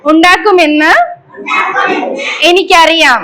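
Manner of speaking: slow, projected recitation in short phrases; leading a pledge. Shown as a demonstration. Teacher leading children in an environment pledge, slow measured call-out. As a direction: call out each phrase firmly, pausing for the echo.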